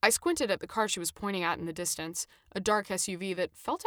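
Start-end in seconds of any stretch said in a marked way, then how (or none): none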